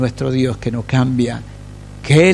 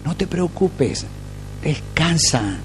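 soothing reassurance; 6 dB quieter, slower than usual. Real, not acted. Preacher soothing worried church.

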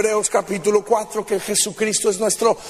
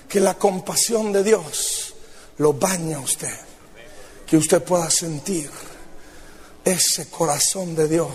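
tender preaching; 5 semitones lower than usual. Acted. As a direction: gently invite each listener in. Intimate altar appeal.

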